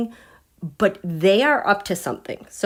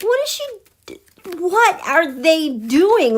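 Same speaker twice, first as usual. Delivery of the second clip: sudden high squeak of confusion; baffled; 8 semitones higher, 4 dB louder than usual. Real, not acted. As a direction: pitch it way up in disbelief. Baffled reaction to the cards.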